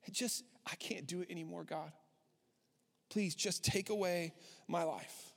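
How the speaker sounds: soft broken prayer, barely above a breath; quiet plea. Acted. Preacher voicing Elijah begging God to end it, hushed and weary. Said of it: "he just cries out".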